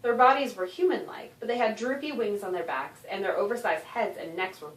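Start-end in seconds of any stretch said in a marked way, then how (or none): none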